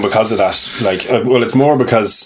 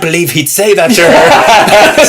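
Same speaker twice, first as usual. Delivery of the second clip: higher, louder, slow scandalised gasp; mock shocked wife; 8 semitones higher, 6 dB louder, slower than usual. Wife reacting to The Crown like it's real, mock shocked. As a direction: play the scandalised wife glued to the show.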